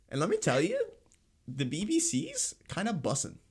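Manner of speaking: normal talking voice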